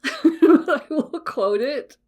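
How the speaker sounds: high, loud, laughing through the words; slow the words down as real laughter takes over. Real, not acted. Podcaster refusing to do the Yoda voice, laughing. Laughs about not doing the voice.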